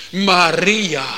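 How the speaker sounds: een roepende Heer, luider; stem van de Heer. Retold or imitated. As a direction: roep haar naam als de opgestane. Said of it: "De bruidegom zelf roept". (de opgestane Heer roept luid Maria)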